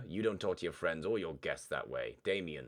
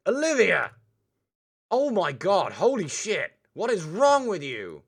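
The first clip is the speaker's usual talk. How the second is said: furious shout, high and loud; angry outburst; 13 semitones higher, 13 dB louder, slower than usual. Acted. Damien explodes at Olivia for her remark, furious shouting. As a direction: explode at her, full volume.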